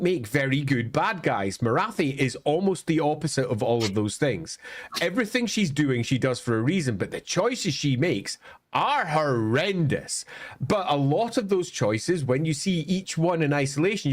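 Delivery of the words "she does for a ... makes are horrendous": usual speaking voice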